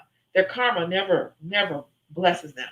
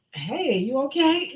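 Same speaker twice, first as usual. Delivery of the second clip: mock concern; 5 semitones higher, slower than usual. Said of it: "I might just be like".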